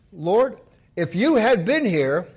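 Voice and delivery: a grieving sister blaming Jesus; reproachful sister. Acted. Sister reproaches Jesus, slow pleading.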